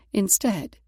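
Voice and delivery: usual speaking voice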